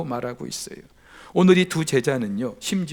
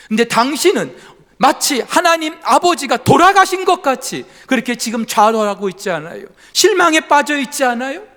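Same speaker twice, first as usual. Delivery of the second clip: louder, higher pointed questions; stern rebuke; 9 semitones higher, 8 dB louder than usual. Acted. Wife sternly confronts her despairing husband Luther, louder and pointed. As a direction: confront him squarely, rising at each question. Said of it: "정색하면서".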